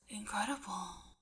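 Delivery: awed whisper; quiet wonder. Acted. Mike marvelling at the flower field, whispered in awe. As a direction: hush it like you can't believe it. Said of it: "voice quiet with wonder".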